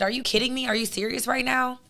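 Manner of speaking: ordinary talk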